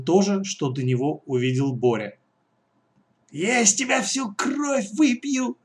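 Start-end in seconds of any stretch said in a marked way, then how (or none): 3.3-5.6 high, slow monster threat; drawn-out bloodthirsty threat from a creature; hissing creature. Acted. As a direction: menace slowly in a high creature voice. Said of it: "прошипело существо".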